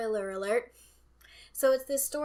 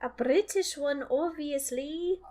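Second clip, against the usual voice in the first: higher, drawn-out mock British; attempted British accent; 4 semitones higher, slower than usual. Shown as a demonstration. Choosing between American and British accents, attempted British accent. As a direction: put on an amateur British accent. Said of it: "my attempt at a British accent".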